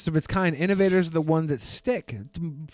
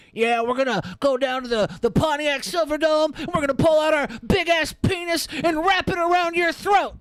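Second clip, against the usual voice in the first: much higher, pumped-up macho promo voice; mock meathead wrestler promo; 10 semitones higher than usual. Comedian mocking old-school wrestlers' promos, higher macho voice. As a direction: ham it up like a roided-up wrestler cutting a promo. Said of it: "most other guys were just like".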